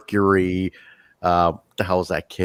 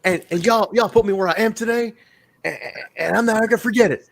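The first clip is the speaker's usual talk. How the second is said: mock AJ Styles promo; 10 semitones higher than usual. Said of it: "so hokey and cheesy".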